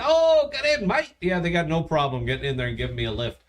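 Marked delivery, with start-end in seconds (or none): 0.0-1.2 high, mock Aussie drawl; mock Australian accent, much higher. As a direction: toss it off like a friendly Australian driver. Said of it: "apparently the Australians".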